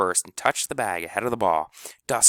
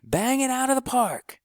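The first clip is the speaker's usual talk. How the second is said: high cheering shout; grinning yell. A narrator's reading. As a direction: call to the batter with joy. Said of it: "grinning, yelling".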